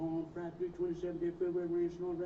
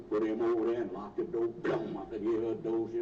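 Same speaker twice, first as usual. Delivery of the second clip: ordinary talk, nothing marked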